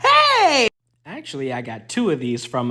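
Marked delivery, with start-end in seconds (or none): 0.0-0.7 high, loud mock yelp of surprise; playful outraged hey; startled hey. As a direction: yelp at being flipped off. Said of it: "I was like".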